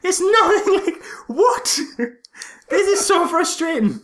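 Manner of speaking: high, loud incredulous ranting; worked-up film rant; exasperated rant. Real, not acted. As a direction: vent your disbelief. Ranting about the Mario movie.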